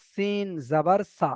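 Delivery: slow, stretched-out syllables; a slow, drawn-out demo of how not to spell it; wrong drawn-out spelling. Shown as a demonstration. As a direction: drag each syllable out, the wrong way. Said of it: "এমন ভাবে বানান হচ্ছে না কিন্তু".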